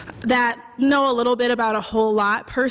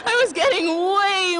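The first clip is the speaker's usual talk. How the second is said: higher voice cracking up with laughter; breaking into laughter; 6 semitones higher than usual. Real, not acted. Storyteller cracking up while telling the audience about her messages, laughing. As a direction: try to get the line out through real laughter.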